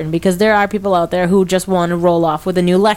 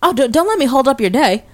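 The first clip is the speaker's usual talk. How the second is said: put-on overly polite dazed kid; 6 semitones higher than usual. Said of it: "and goes".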